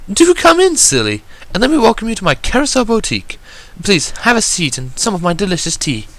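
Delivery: high, refined lady's welcome; a posh shopkeeper gracefully inviting a guest in; assured Rarity voice. A narrator's reading. Rarity welcoming a guest to her boutique, assured and high. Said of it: "Rarity requested suddenly in an assured voice".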